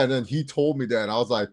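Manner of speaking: normal talking voice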